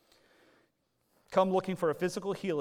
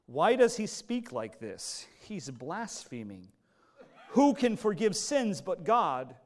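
slow, loud, half-laughing mock outrage; mock indignant scribes; 3 semitones higher, 6 dB louder, slower than usual. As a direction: scribes scoffing in disbelief, played slow with a chuckle. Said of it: "the scribes speaking within their hearts".

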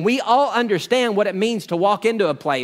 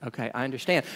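quiet mock agreement; 7 semitones lower, 10 dB quieter, faster than usual. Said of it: "Mick Murray would go".

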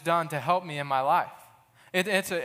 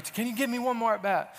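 higher, pleading coach voice; intense coach; 6 semitones higher than usual. Retold or imitated. Coach repeating his plea, intense. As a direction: press him for one more try. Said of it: "But he's really".

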